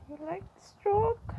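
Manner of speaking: cooing high at a pet rabbit; cooing pet talk. Real, not acted. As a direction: coo the question up high to the rabbit.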